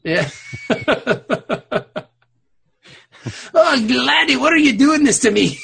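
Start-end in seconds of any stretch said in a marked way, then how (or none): 3.5-5.6 high, loud Scottish wail; exaggerated Scottish outcry; mock Scottish accent. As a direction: plead in a hammed-up Scottish accent. Resumes the bad Scottish character.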